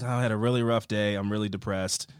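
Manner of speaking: low, quiet, downcast grumble; mock depressed. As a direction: play a worn-out adult feeling sorry for themselves. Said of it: "for a twenty-eight-year-old, you're like".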